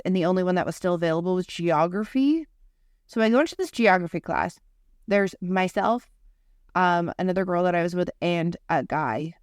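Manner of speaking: usual speaking voice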